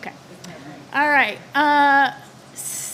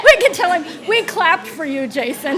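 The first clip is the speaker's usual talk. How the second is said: loud, high, through laughter; laughing; 4 semitones higher, 7 dB louder than usual. Real, not acted. Chair to staff about the superintendent, laughing loudly.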